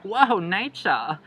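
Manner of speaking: slow hushed nature-documentary wonder; David Attenborough. Retold or imitated. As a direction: narrate like a wildlife presenter. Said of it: "like a David Attenborough documentary".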